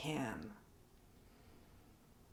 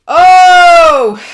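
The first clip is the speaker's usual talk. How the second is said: a sudden high oh, much louder; loud visceral cry; 9 semitones higher, 21 dB louder than usual. Real, not acted. Vlogger releasing emotion on camera, loud high oh. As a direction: blurt out a big emotional oh. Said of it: "A lot of visceral expression".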